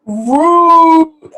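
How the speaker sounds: high, loud stunned repeat of "Rude."; shocked echo. Real, not acted. Reacting to the husband on screen, high shocked echo.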